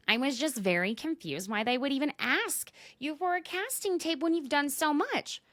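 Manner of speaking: high-pitched mock-innocent confusion; voicing Taylor. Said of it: "Back to Taylor".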